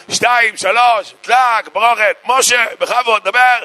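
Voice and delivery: high, shouted miracle announcement; hammed-up ringmaster cry, pitched high; showman's countdown. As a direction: call it out grandly to the whole crowd. Faith healer's big countdown.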